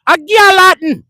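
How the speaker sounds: high, loud Jamaican "A Galhattan"; Jamaican accent. Retold or imitated. Jamaican friend answering the question, in a loud high Jamaican accent. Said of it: "That's what my Jamaican man said".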